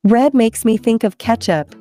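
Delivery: ordinary delivery, nothing marked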